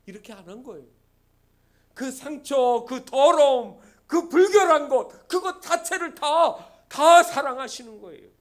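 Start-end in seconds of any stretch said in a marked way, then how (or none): 1.9-8.3 loud, hammered list building to a climax; emphatic preaching. Real, not acted. Preacher declaring that God loves every wound and filth, emphatic proclamation. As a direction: drive each item home with force.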